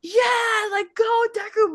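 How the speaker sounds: high, loud rooting for a hero; mock cheer. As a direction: cheer them on from the crowd.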